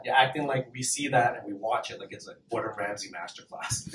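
ordinary talk

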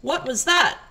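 two speakers layered into one line; two voices at once. Acted. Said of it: "try doing two voices at once".

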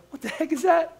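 high, soft disbelieving squeak; sermon aside, mocking cheat day in a high squeak; incredulous mock squeak.

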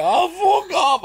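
put-on high, loud drunk shout; disbelieving drunk mate. Drunk friend shouting at the teller, high and loud.

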